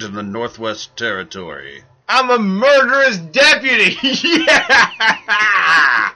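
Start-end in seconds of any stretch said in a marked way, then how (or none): 2.1-4.0 excited. Real, not acted. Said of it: "excited at his role". 4.0-6.1 laughing cheer. Real, not acted. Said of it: "laughing cheer".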